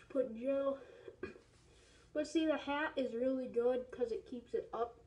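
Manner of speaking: muffled inside full-head mask; through a rubber mask. Real, not acted. Seen 1: Full rubber horse-head mask covers the whole head while speaking.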